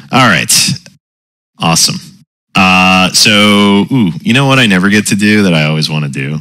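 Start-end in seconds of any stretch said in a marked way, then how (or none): none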